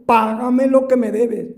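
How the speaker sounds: slow, loud threatening demand; angry servant demanding payment; choking demand. Acted. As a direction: growl it while throttling the debtor. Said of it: "le ahogaba diciendo".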